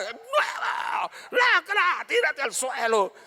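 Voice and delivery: high barking drill instructor yell at a recruit; drill sergeant yelling. Retold or imitated. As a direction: bark it at the rookie like a sergeant. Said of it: "recién llegado a escuela militar". (sargento gritándole al novato)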